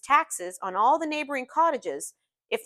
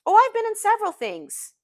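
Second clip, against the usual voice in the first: high, loud, hasty-sounding self-correction; correcting himself; 11 semitones higher, 7 dB louder than usual. A narrator's reading. Gatsby amending his reply about business, correcting himself. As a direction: cover the slip with a lifted voice. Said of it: "he corrected himself".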